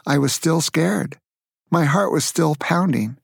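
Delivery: usual speaking voice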